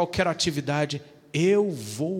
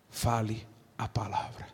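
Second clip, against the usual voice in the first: whisper; 6 semitones lower, 6 dB quieter than usual. Acted. God prompting quietly.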